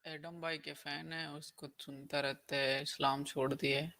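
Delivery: faint, distant call audio; weak mic, voice barely coming through; barely audible. Real, not acted. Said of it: "आपकी आवाज़ कम आ रही है".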